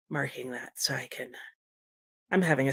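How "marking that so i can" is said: a soft whisper to herself while noting it down; whispered note to self; whispered aside. Real, not acted. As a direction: whisper it to yourself while marking. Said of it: "mutters to herself".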